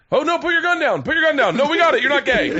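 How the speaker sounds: calm down an armed rapper in a hurry; hammed-up scared studio producer; mock panic. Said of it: "in the studio like".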